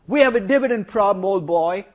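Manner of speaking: loud, hammed-up Oxbridge accent; a lightly done posh English accent. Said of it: "My Oxbridge accent is not that good".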